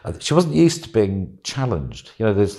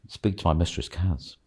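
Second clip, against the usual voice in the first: softer, mildly dazed imitation; entranced servant; 3 semitones lower, 6 dB quieter than usual. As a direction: answer as if lightly hypnotized. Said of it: "You know, in the trance".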